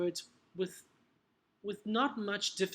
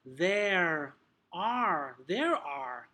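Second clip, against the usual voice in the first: American "there are", strong r on both words; drawn-out, emphatic American r in "there are"; American accent; 3 semitones lower, 3 dB louder, much slower than usual. Shown as a demonstration. Teacher contrasting accents for trainees, American accent. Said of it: "If it's gonna be more American".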